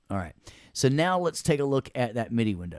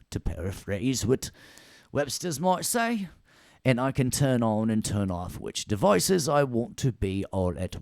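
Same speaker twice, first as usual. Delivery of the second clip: put-on British accent, swooping on key words; 3 dB louder than usual. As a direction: explain in a mock British lecturer's voice. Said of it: "talking British when I was talking about Webster".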